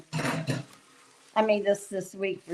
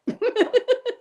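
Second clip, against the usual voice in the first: bright high burst of laughter; giggling at herself; 4 dB louder than usual. Real, not acted. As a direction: laugh it off, pitched up.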